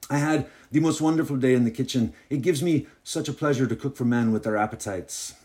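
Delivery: gushing hostess in a put-on French accent; thick French accent. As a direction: lay on an amateur French accent. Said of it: "Her accent seemed somehow even thicker".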